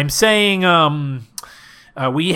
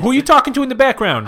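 high fast voice; 9 semitones higher, faster than usual. Acted. Brenda on phone.

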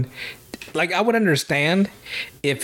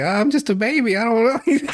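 put-on weird baby voice; 8 semitones higher than usual. Old guy in a diaper dodging payment, whiny baby voice. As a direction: whimper like a grown man playing a helpless baby. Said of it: "weird baby boy".

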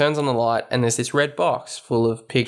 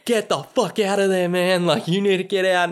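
mock urgent warning; 7 semitones higher than usual. Retelling Rod urging Chris to flee.

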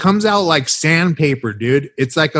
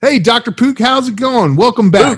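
hammed-up host greeting; 8 semitones higher than usual. Introducing guest.

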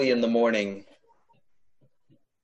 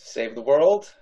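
ordinary delivery

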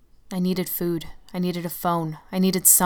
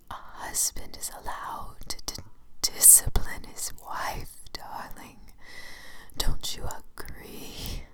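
menacing whisper; 6 dB quieter, slower than usual. Acted. The shadow waltzes with her.

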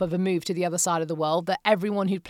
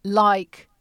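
normal talking voice

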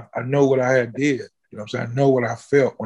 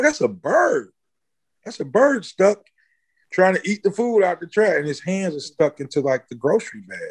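high, startled exclamation as he relives spotting the bird; excited retelling; 6 semitones higher than usual. Retold or imitated. To his friends, amazed at a bird stuck in the trash, high and startled.

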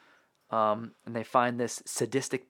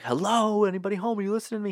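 mock exasperated [name]; 9 semitones higher, much faster than usual. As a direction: taunt it like knocking on an empty head. [name] knocks on Michael's head.

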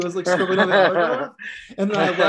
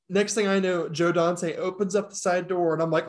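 normal talking voice

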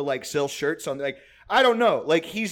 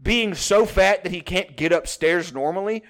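mock exasperation; 5 semitones higher, 3 dB louder than usual. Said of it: "when you're like".

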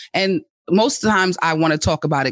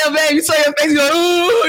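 teasing partner through laughter, higher; laughing tease; 9 semitones higher, 4 dB louder than usual. Retold or imitated. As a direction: giggle out the tease, mock-impressed. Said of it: "laughing like".

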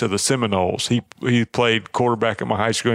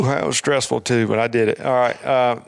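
usual speaking voice

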